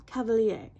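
"Cavalier" as "ka-va-lee-AY", falling; attempted French name. Shown as a demonstration. Vlogger settling on the perfumer's name, "Cavalier" ending in a falling "lee-ay". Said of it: "don't correct me".

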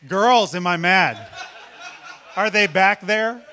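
loud laughing shout to the back; dad calling to his daughters at the back, laughing. Real, not acted.

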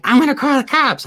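high-pitched mock threat; hammed-up indignant mom; outraged mom. Retold mom threatens to call cops.

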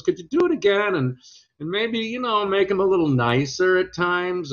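high-pitched imitation of a comedian making a request; Jerry. Jerry asks the actor for a nicer take, imitated high voice. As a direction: stay up high, as if mimicking him. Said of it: "So he goes".

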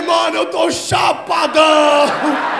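very slow, loud, laughing declaration; stoned shout. Retold or imitated. [name] telling his friend he is stoned, slow loud laughing shout. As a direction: stretch it out, amazed at being high. Teller realizes he's high.

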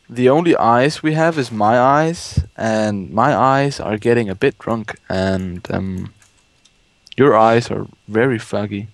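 ordinary talk

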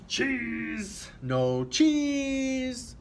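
high, loud pirate shout; pirate yell. A narrator's reading. Pirate yelling at the camera elf, high and loud. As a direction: bellow it like an angry pirate. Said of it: "He will yell".

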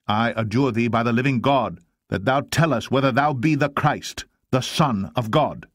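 high, forceful demand; adjuring high priest. Acted.

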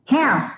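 "Ham" with a breathy English h; model word. Shown as a demonstration. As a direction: say "Ham" clearly for class to repeat. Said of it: "Repeat me". ("Ham" com h aspirado inglês)

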